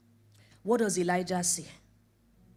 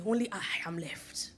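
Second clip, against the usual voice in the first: whispered lament; 4 semitones lower, 5 dB quieter than usual. Retold or imitated.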